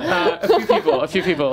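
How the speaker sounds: giggling through the words; laughing. Real, not acted. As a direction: laugh while dodging the question. Evasive about who they're talking to.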